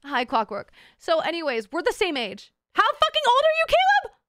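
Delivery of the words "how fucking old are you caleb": high, loud disbelief; shocked. Real, not acted. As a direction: shriek it in disbelief. Shocked at chatter's age.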